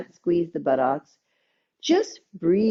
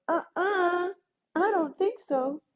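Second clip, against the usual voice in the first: much higher, sassy no-way voice; sassy refusal; 11 semitones higher, 3 dB quieter than usual. Acted. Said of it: "the nervous system goes".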